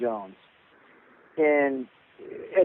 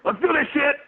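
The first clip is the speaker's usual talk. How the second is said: high-pitched cartoon voice; cartoon character; 13 semitones higher, 3 dB louder than usual. Cartoon guest answers the podcast host's introduction, high cartoon voice. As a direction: squeal it like an eager cartoon sidekick.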